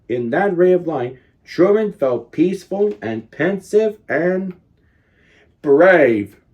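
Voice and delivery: usual speaking voice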